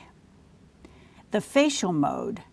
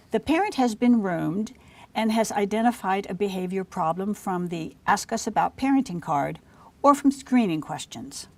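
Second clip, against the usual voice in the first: ordinary talk, nothing marked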